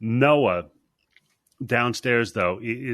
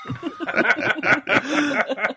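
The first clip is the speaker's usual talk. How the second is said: cracking up. Real, not acted. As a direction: laugh helplessly at the joke. Cracking up at the joke.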